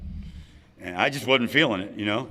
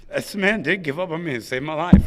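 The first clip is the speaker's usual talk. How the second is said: ordinary talk, nothing marked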